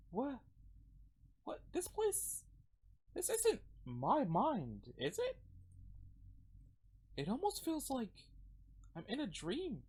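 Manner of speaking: put-on high, softly puzzled girl voice; young girl character. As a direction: wonder aloud in a high, hesitant voice.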